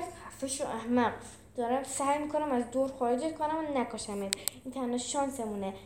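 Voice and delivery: plain reading voice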